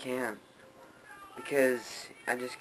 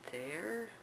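unsure question; 9 semitones higher, 11 dB quieter than usual. Real, not acted. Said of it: "I don't know".

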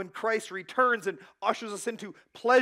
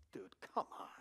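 whisper; 12 semitones lower, 15 dB quieter than usual. Real, not acted. Exasperated aside to the congregation.

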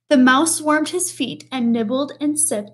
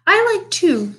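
mouse voice; 6 semitones higher, 4 dB louder than usual. Acted. Mouse answering tea.

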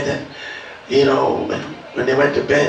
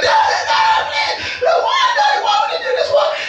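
put-on very high, desperate child's wail; crying children. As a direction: scream for Daddy like a terrified child. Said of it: "the kids were crying".

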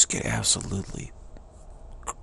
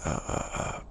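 ordinary delivery

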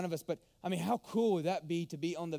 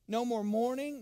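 normal talking voice

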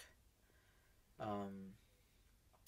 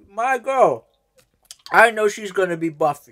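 hyped shouting rant; 7 semitones higher, 12 dB louder than usual. Pretend.

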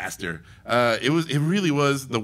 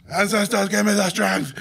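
loud plea; 8 semitones higher, 5 dB louder than usual. Retold or imitated. Second plea to the ancestors.

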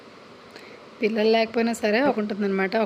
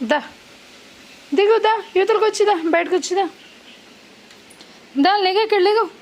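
much higher, louder calls to come; calling Bruno to come out of the car; calling the dog; 8 semitones higher, 5 dB louder than usual. Real, not acted. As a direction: coax the dog out with bright repeated calls.

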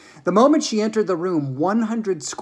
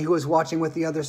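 ordinary delivery, nothing marked

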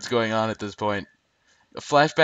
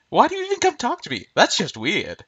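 much higher, squeaky put-on character voice; high comic impression of the guy; Stomper's voice; 8 semitones higher than usual. Shown as a demonstration. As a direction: jump way up into a cartoon voice. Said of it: "if I could do the accent, This guy would be voiced by Stomper".

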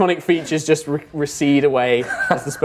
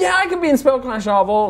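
put-on hyped-up teen insisting; high, eager protest; Vala; 10 semitones higher, 4 dB louder than usual. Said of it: "super hyped up teenager".

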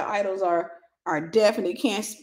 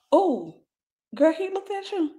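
gossiping friend; 8 semitones higher than usual. Acted. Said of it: "That pick me, that girl".

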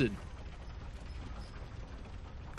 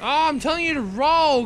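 frustrated yelling; 6 semitones higher than usual. Real, not acted. Gamer shouting at his character to dodge roll, exasperated yell. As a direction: bellow the order in exasperation.

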